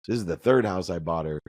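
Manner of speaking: ordinary delivery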